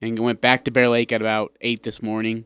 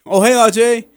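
mock cheery greeting; 9 semitones higher, 5 dB louder than usual. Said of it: "and you come over".

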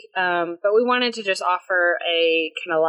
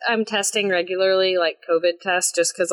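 normal talking voice

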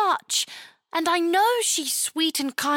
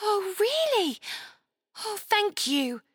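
put-on relieved girl; 4 semitones higher than usual. A narrator's reading. Chloe relieved and grateful.

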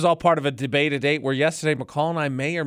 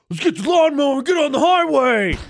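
put-on drunk impulse; 12 semitones higher, 5 dB louder than usual. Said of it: "never have I ever been like".

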